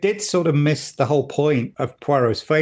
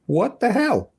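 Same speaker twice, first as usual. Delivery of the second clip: incredulous, fed up with the show; exasperated outburst; 11 semitones higher, slower than usual. Real, not acted. Podcast host ranting to co-host, exasperated disbelief. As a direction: pitch jumps way up in disbelief. Annoyed rant about accents.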